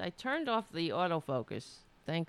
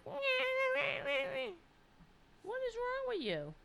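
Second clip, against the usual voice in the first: much higher pretend cat voice; cat's voice; 4 dB quieter than usual. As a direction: speak for the puzzled cat. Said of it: "He's like".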